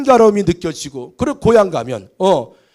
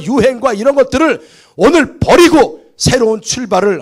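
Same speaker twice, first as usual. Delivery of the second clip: loud, high sermon delivery, words hammered out; a pulpit voice ringing with conviction; forceful preaching; 8 semitones higher, 6 dB louder than usual. Acted. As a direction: preach it to the whole hall. Preacher opens at full force.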